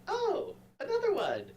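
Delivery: very high squeaky fairy voice; a tiny fairy delighted at another guest. Acted.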